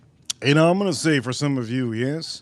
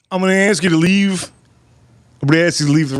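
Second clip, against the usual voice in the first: raised, louder dismissal; stern waiter; 3 semitones higher, 5 dB louder, faster than usual. Acted. Waiter ordering customer out of restaurant, stern and raised. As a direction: play a waiter putting their foot down.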